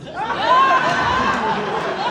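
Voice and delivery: high, loud burst of laughter. Retold or imitated. Retelling the shaky flight, a high loud laugh. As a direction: let a big high laugh break out. Mocking panicked plane passengers.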